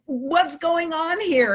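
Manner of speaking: high and slow, pleading; slow high plea to God. Retold or imitated. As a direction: ask God, bewildered. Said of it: "pleading with God".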